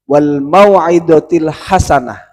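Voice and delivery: Quran recitation. Acted.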